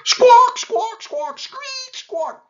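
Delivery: loud, high hornbill screeches; squawking bird. A narrator's reading. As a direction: screech piercingly like a bird singing at night. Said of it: "it's me singing".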